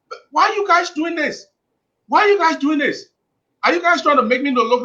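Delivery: arguing back on a radio panel, exasperated. Real, not acted. Heated protest at hosts.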